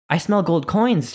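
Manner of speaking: lightly voiced little girl shrieking; mock shriek. A narrator's reading. Small girl sniffing for gold, mild mock shriek. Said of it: "shrieks a four-year-old girl".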